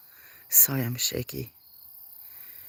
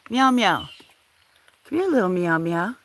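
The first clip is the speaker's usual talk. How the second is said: high sing-song pet call, louder; calling a cat; 6 semitones higher, 4 dB louder than usual. Real, not acted.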